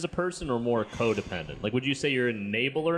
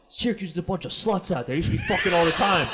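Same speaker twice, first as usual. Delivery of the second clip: put-on New Jersey accent. Dad urging son in New Jersey accent. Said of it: "I also gave him a New Jersey accent".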